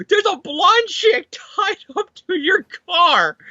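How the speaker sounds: high, slow mock dismay; alarmed objection. Max objecting to Gwen tied to the car, mock alarm. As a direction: draw it out in a high, appalled voice.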